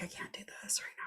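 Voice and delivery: whispered. Retold or imitated. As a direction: breathe it out like you can barely cope. Reaction to his Snap add.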